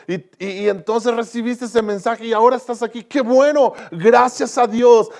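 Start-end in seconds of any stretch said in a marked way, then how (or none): 3.0-5.1 high, loud burst of joy, slowing on gracias a Dios; delighted praise; joyful exclamation. Real, not acted. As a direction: exult over the saved listener. Preacher rejoices over salvation.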